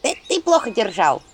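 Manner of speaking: high squeaky blaming; reproach. Acted. Imp blaming her partner for letting go. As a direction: blame him with a squeak. Imps quarrel.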